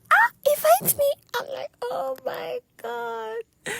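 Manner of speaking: very high, thrilled mock squeal; retold excited squeal. Retelling a podcast invitation, squealing with excitement. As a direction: squeak it out as if starstruck.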